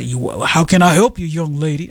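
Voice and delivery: a lightly put-on manly old-man voice; imitating her own attempt at a manly voice as she flirts; dirty old man voice.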